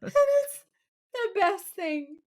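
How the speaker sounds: high, slow gush through laughter; delighted, helpless giggly praise; laughing while talking. Real, not acted. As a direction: squeeze the words out between laughs. Cracking up over favourite scene.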